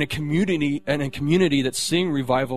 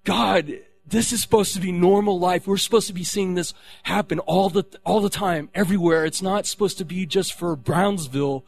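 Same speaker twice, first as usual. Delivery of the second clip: raised, higher frustrated pleading; mad at God; 5 semitones higher, 4 dB louder than usual. Retold or imitated. Retelling his frustrated prayer to God, raised angry voice. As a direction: demand answers from God, hot and loud. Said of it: "and I was mad".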